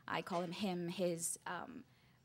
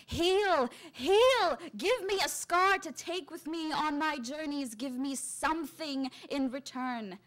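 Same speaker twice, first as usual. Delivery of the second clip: loud, high, drawn-out plea, easing toward the end; desperate begging; 8 semitones higher, 10 dB louder, slower than usual. Acted. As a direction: cry out and beg, hardest at the start. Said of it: "turn to my wound and beg it".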